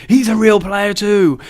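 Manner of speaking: loud, high, drawn-out exclamation; excited discovery. Real, not acted. Gamer to viewers on finding another real player, excited discovery. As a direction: call it out with delighted surprise.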